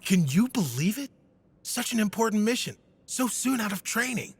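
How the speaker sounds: put-on a young knight who can't believe his luck; thrilled voice of young Balthion; excited young knight.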